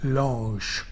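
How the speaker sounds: "Lounge" mispronounced for fun with a soft zh ending; joke pronunciation. Shown as a demonstration. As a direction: say "Lounge" like a mock-French "lohnzh". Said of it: "How do you pronounce that word?".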